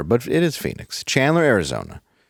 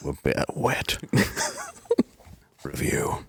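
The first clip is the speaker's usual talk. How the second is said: sultry narrator; 6 dB quieter than usual. Pretend. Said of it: "sultry voices in your ear like this".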